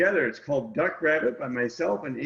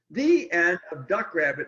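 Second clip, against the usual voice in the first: ordinary delivery, nothing marked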